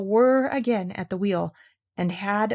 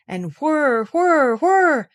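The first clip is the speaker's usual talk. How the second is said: rising, louder whir whir whir; whirring wheel; 6 semitones higher, 5 dB louder, much slower than usual. A narrator's reading. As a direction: make the spinning wheel hum. Spinning wheel sound effect.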